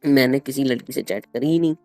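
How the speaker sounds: usual speaking voice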